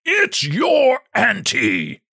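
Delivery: high, slow, howling roar; ferocious howl. A narrator's reading. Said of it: "His voice arose to a howl of ferocity".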